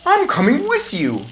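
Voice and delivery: put-on high boy talking through food; words round a mouthful; mouth full. A narrator's reading. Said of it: "speaking with his mouth full".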